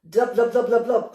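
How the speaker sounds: loud bubbling cries; crying out. A narrator's reading. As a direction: call out the blubs while clinging on. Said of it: "cried Baby Bear".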